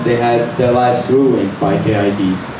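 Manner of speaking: normal talking voice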